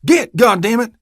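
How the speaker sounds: loud, high shooing yell; shout. Acted. Deputy shooing the dog, angry shout. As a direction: drive the dog off angrily. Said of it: "I heard Orban shout".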